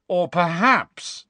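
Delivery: slow, high, drawn-out needling; leering taunt. A narrator's reading. Malfoy backs away from Harry, leering taunt. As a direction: dangle the insult before landing it. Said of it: "leering as he backed away".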